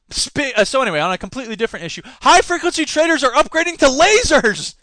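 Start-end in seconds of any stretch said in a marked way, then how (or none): 2.2-4.7 high, loud, hyped-up announcement; mock-excited headline. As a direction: announce it with over-the-top excitement. Mock-thrilled reading of silly headline.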